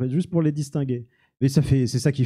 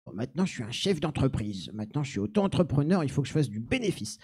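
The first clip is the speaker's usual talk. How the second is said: softer, lightly acted inner voice; mock self-talk; 8 dB quieter than usual. Mocks himself as budding entrepreneur. (petite voix intérieure légèrement jouée)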